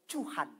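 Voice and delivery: "Tuhan" said with a ch sound, as "Chuhan"; mock plea, "Tuhan" softened to "Chuhan"; mispronounced prayer. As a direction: turn the T of "Tuhan" into a ch. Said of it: "Bukan cu-, Tuhan lagi, tapi Tuhan".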